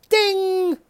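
high sung ding; mock game show ding; ding chime. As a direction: imitate the correct-answer bell.

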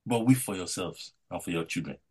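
rising, fervent preacher's voice with loud peaks; preaching. Acted. Pastor exhorting the brethren about Luke twenty-three, fervent preaching. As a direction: preach it to the congregation with conviction. Preacher delivering his sermon.

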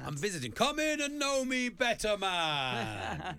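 high, loud theatrical spirit voice; hammed-up spooky visitor; hammed-up ghost voice. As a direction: boom it out like a Dickens ghost. Acting a Christmas Carol figure.

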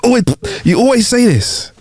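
high-pitched, stumbling accusation; exasperated husband in a domestic row. Acted. Acted husband accusing wife in quarrel.

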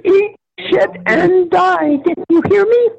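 put-on very high, squeaky child cursing; a sweet little girl swearing like a sailor; bratty little girl voice. Retold curse repeated.

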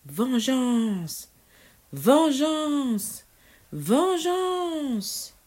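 high, long-drawn witch's cry, climbing with each repeat. A narrator's reading. Furious witch swearing revenge, crying out. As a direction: proclaim it in mounting, stretched-out fury. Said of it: "elle s'écria".

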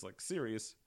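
normal talking voice

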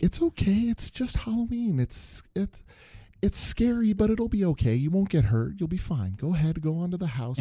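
high-pitched, reassuring parent voice; imitated soothing parent, pitched well up; parents coaxing a scared child. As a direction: coax a nervous little one along in a high voice.

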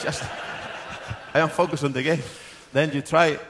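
loud laughing reply; real laugh carrying the words. Real, not acted. Laughing about chants.

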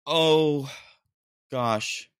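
ordinary talk, nothing marked

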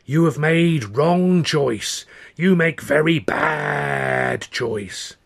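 very slow, high, loud wailing crone; a loud, drawn-out fortune teller's wail; awful wailing. Acted. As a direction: wail the prophecy like a doomed seer. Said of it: "she starts this awful wailing".

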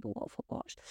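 whispered aside to herself; whispered to self. Real, not acted. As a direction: murmur it to yourself under breath. Muttering to herself, unvoiced.

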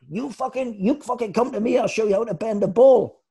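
high-pitched, indignant manager impersonation; Danny Begora. Retold or imitated. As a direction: challenge him in a put-on high voice. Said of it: "it was Danny Begora".

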